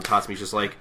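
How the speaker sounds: ordinary talk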